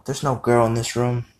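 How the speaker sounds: ordinary talk, nothing marked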